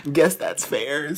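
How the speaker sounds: slow, high-pitched concession; mock acceptance. Repeats the retold reluctant acceptance.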